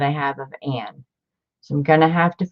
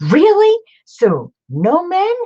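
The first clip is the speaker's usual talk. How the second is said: squeaky, much higher, louder mock disbelief; mock shocked son; 14 semitones higher, 7 dB louder than usual. Retelling her son hearing nuns live without men, mock shocked. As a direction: play the son, stunned there are no men. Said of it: "And he's like".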